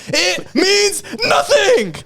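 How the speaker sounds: drawn-out, high overacted wail; mock anguish, stretching each word; retold scream. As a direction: repeat it as a hammy stage yell. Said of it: "keeps screaming that line".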